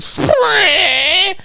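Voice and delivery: long high screech of a car scraping; imitated scrape noise.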